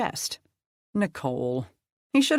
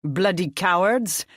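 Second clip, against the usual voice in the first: disgusted; 4 semitones higher, 4 dB louder than usual. A narrator's reading. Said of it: "his French accent tinged with disgust".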